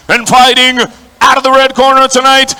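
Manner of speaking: big stretched-out shout of the fighter's name; ring announcer call. Acted. Ring announcer introducing the red corner fighter to the stadium, drawn-out shout.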